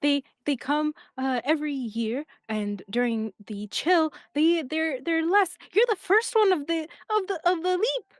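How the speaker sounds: put-on older sister explaining the carts; a light childlike voice; badger kid.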